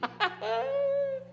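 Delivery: very high, soft giggle; gleeful character laugh. Acted. Gator king tickled by trouble, high gleeful giggle.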